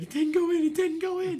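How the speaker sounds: high, loud panicked pleading; yelling at the TV as the puck crosses; frantic disbelief. Retold or imitated. Retelling watching the losing goal.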